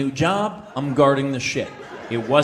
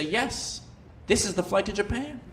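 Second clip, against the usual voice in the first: lightly voiced gate agent; 6 semitones higher, 6 dB quieter than usual. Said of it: "The gate agent would say".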